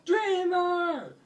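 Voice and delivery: very high cheer of greeting; happy hail. Acted. Happy townspeople greet their rescuer, high excited call.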